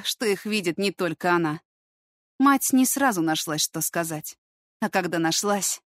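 ordinary delivery

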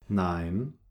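normal talking voice

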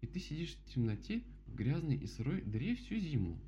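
high-voiced bear making a point; story bear, summing up. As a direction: spell it out in a high character voice. (мишка рассуждает высоким голосом)

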